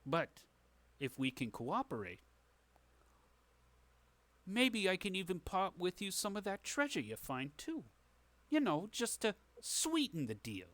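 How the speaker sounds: sly fixer sweetening a deal; shady dealmaker. Acted. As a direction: wheedle like a crooked businessman. Game master voices shady dealmaker.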